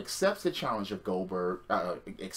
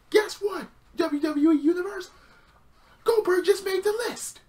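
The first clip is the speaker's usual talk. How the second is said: high, loud announcer hype; mock wrestling announcer, high and loud; hyped announcer; 6 dB louder than usual. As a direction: belt it out like a ring announcer. Hyping Goldberg's move like announcer.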